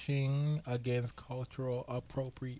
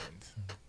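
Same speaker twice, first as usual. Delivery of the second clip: stifled giggles; 4 semitones lower, 10 dB quieter than usual. Real, not acted. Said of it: "I was trying not to laugh".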